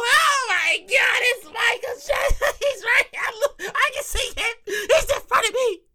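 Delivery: hammed-up tearful fangirling; hysterical fan. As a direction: squeal as if your idol is right there. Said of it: "Everybody all crying and screaming".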